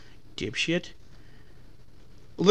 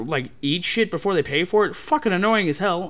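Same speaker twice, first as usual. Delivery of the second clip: ordinary talk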